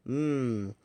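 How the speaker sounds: loud, high greedy mmm over food; loud yummy moan. Acted. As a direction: moan with pleasure at the bacon. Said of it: "don't make sounds like that".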